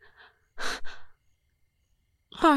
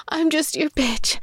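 ordinary talk

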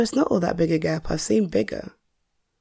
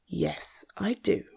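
whispering; 9 dB quieter, slower than usual. Acted. Confessing secret pen name.

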